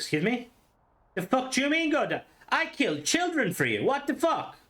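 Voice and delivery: high-pitched, incredulous protest; mock outrage. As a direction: act out a player indignantly answering a god. Acts out outraged player reply.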